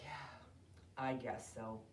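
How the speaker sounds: low, soft, reluctant agreement; subdued and downcast; sad concession. Acted. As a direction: admit it sheepishly. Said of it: "Henry is so sad".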